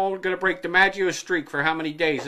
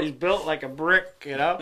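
ordinary talk, nothing marked

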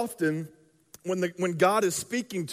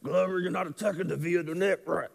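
mumbling coach; 4 dB quieter than usual. Retold or imitated. Coach mumbling at his player over practice film, frustrated. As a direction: mutter it under your breath like a frustrated coach. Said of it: "he'd mumble like this".

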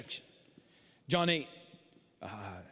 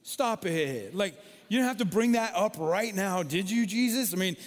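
louder, higher playful protest; mock scolding; 5 semitones higher, 6 dB louder than usual. Real, not acted. Preacher jokingly rebuking Jesus for shaming her, louder and higher. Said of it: "I took classes in psychology".

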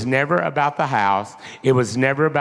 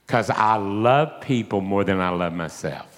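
low, gentle voice of the Lord; tender confession; 8 semitones lower than usual. Retold or imitated. Preacher voicing the Lord, tenderly.

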